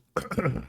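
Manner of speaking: sharp throat clear after choking. Real, not acted. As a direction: clear water from your throat. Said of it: "Water goes down the wrong pipe".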